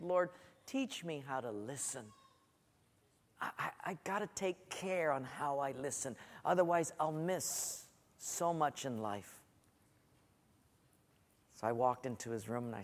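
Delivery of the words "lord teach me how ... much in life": soft, lowered, earnest plea; convicted prayer. Retold or imitated. Dad praying to the Lord for listening ears, convicted and hushed. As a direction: pray quietly, humbled. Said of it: "I felt so convicted".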